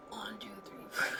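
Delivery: a dry throat that barely makes a sound, soft; dry whisper. Real, not acted. Showing viewers her dehydrated throat, a dry whisper. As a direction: voice gone, only a dry whisper. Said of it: "Because I'm severely dehydrated".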